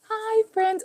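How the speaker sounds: put-on high cooing baby-talk greeting; voicing the baby. Mom voices her baby girl greeting viewers, high baby-talk. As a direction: speak for the baby, high and sweet.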